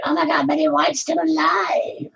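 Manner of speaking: high, slow, gushing "Oh my God" voice; parroting everyone's amazement; mock gushing crowd. Mocking how everybody gushes, high and slow.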